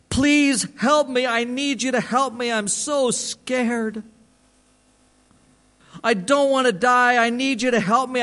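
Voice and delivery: high, drawn-out tearful pleading; imitating a man's desperate, wailing prayer; sobbing prayer. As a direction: pray it as if through sobs. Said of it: "he sobbed".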